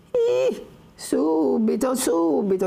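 put-on old servant hurrying to obey; squeaky old woman. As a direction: answer in a shrill hurry. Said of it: "rispondeva la vecchia".

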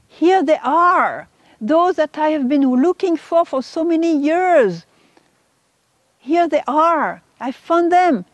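amazed recognition. Retold or imitated. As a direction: exclaim it like a long-awaited find. Retold thought on finding believers.

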